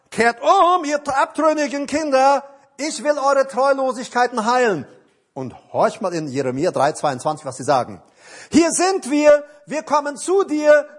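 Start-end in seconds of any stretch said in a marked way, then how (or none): 0.1-4.9 very high, loud preaching cry; thundered divine summons. Acted. God summons straying children, shouted proclamation. Preacher cries out God's call. 8.5-10.9 very high, ringing declaration; loud answering cry. Acted. The people answer God, in a loud high cry.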